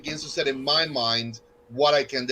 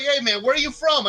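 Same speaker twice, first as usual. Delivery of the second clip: higher, brighter mock stranger asking; imitating a curious stranger; 10 semitones higher than usual. Strangers quizzing Duncan about his accent, mock stranger voice. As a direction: ham up the nosy outsider. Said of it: "But they're like".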